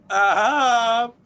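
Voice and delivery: high, loud triumphant crow; gloating. Real, not acted. Champion gloating at challengers, a loud triumphant crow. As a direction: crow it over everyone. Gloating that he still holds title.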